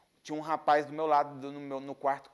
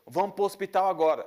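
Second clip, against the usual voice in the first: loud, raised-pitch command; father's urgent order; 5 semitones higher, 7 dB louder than usual. Retold or imitated. Father ordering his son to hospital, urgent and loud. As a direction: imitate Dad insisting, louder and higher.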